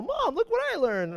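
put-on high-pitched child's voice; excited kid. Kid telling mom about the lesson, excited child voice. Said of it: "Kids are all".

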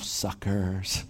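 smug thought. Retold or imitated. Smug thought passing stuck cars.

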